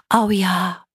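delighted, nasal agreement; stuffed-nose girl. A narrator's reading. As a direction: accept gladly through a blocked nose. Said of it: "Ihre Stimme klang, als wäre ihre Nase verstopft".

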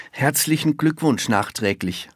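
courteous, a little lifted in pitch; polite. A narrator's reading. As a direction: say it like a well-mannered boy. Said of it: "sagt Jan Arne höflich".

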